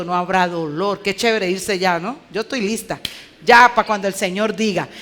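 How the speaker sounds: ordinary talk, nothing marked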